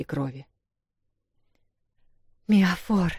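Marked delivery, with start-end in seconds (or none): 2.5-3.1 breathed name. Acted. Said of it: "выдохнула Вэл".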